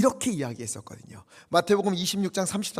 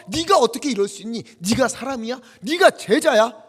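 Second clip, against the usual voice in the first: loud scolding of a failed disciple; a wounded master shouting at a disciple who ran away; imagined rebuke; 6 semitones higher, 8 dB louder than usual. Pretend. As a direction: berate him like a betrayed teacher.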